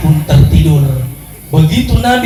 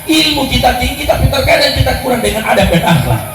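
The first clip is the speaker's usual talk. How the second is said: fiery mosque sermon build; rising preaching fervour; 6 semitones higher than usual. Acted. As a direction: preach it harder line by line, peaking on tidak salah. Preacher building to full force.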